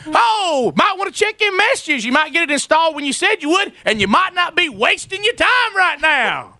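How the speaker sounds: very high-pitched mock concern; sarcastic rant. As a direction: taunt him with exaggerated, high-voiced sympathy. Sarcastic rant continues after interjection.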